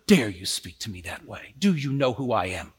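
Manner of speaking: imagined woman's lofty how-dare-you; haughty indignation. Retold or imitated. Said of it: "she doesn't say".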